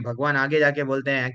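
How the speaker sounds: ordinary talk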